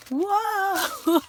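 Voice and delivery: loud, high, drawn-out exclamation; amazed whoa. Real, not acted. Crafter seeing some pretty big dies, loud and astonished. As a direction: burst out in real astonishment.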